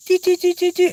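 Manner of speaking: very high, repeated chittering notes; imitated squirrel call. Hunter doing the quarter trick, high imitated chatter. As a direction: mimic a squirrel call in high, even pips.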